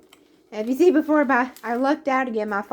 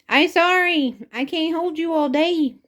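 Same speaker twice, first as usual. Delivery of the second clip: higher, louder cooing sorry; pet talk apology; 6 semitones higher, 4 dB louder than usual. Real, not acted. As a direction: soothe an annoyed cat. Apologising to her cat.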